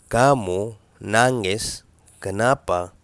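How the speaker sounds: "كامو نانجس كنابا" said slowly, word by word; slow teaching repeat. Shown as a demonstration. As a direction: spell it out slowly for the learner. (نطق بطيء وواضح لـ"كامو نانجس كنابا")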